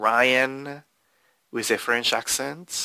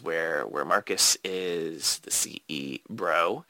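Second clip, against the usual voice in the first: whispered aside; 7 semitones lower, 6 dB quieter than usual. Real, not acted. Aside about CEO bro.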